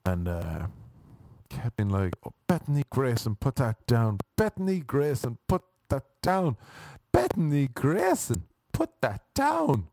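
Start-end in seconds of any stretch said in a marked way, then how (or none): 2.2-9.9 mother calling out the name in higher, rising singsong; mother scolding her child. Retold or imitated. Woman telling her child off in chicken place, scolding. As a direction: put on a scolding mum, pitch jumping high. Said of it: "But obviously in an American accent".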